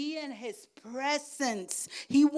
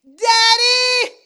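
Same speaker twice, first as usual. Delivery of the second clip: loud, much higher desperate cry of Daddy; shout to Daddy; 12 semitones higher, 19 dB louder than usual. Retold or imitated. Believer in pain crying to God, loud desperate shout. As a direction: yell it out for help. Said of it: "instead of saying".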